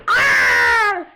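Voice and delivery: loud high wolf scream. Acted. Wolf screaming at the robot pig, loud. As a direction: scream in fear. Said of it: "screamed the wolf".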